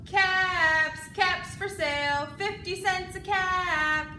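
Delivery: loud singsong street call; peddler calling wares. Acted. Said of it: "he called".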